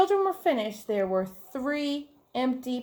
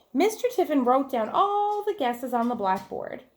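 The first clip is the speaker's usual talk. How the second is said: ordinary delivery, nothing marked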